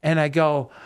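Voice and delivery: normal talking voice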